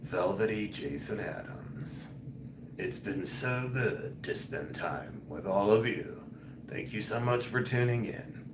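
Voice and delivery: put-on velvet late-night host, murmured; velvety chest voice. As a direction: croon a soft sign-off from your chest. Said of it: "Let me talk from my chest".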